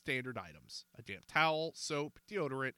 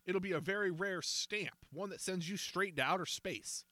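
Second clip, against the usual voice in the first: threatening; 6 semitones higher than usual. A narrator's reading. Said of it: "Baldy threatened".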